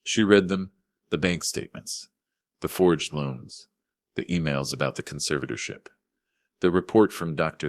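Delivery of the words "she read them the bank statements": plain reading voice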